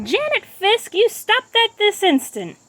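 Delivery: high, slow scolding teacher; stern teacher. A narrator's reading. Teacher scolding Janet, high, slow and stern. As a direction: pin the culprit with a stern command. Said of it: "Miss Avery announced".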